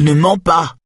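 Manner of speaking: high sharp shout. Acted. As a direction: yell it at him. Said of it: "cria-t-elle".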